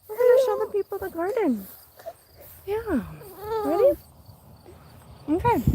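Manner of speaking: calm the little one after the pea. Real, not acted. Mom soothing her upset baby before the garden tour, high baby talk.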